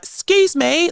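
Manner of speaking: high, polite posh English "Excuse me"; a proper English accent, asking nicely. Retold or imitated. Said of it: "like, proper English accent".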